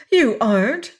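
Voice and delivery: quick loud protest; breaking in. A narrator's reading. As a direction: cut in sharply. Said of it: "broke in Estelle".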